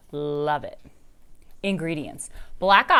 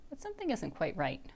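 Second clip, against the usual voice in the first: ordinary delivery, nothing marked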